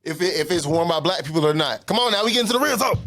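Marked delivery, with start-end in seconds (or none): 1.8-3.0 fired up. Real, not acted. Agreeing hotly in race debate.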